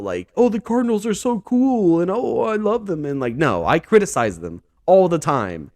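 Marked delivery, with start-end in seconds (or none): 0.4-3.1 high, gushing fan voice; mock delusional fan. Parodying a delusional Cardinals fan, high gushing voice. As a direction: gush like a blind superfan. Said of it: "in my own bubble".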